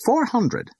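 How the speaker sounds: high, squeaky bid; squealed. A narrator's reading. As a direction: squeal the number out excitedly. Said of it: "squealed the pink woman".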